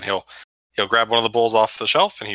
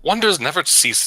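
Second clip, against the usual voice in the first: slow, higher, badly faked Russian accent; attempted Russian accent; 7 semitones higher, slower than usual. Game master as a Tabaxi marvelling at Chult, attempted Russian accent. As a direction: have a shaky go at a Russian accent. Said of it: "I can't do a Russian accent".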